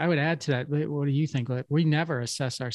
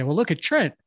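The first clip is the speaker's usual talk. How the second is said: higher and louder, a mock whine blaming a coworker; whiny deflecting employee; 8 semitones higher, 4 dB louder than usual. Employee deflecting blame onto Trent, higher mock whine. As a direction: play the defensive worker pointing at Trent. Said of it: "they immediately want to deflect and say".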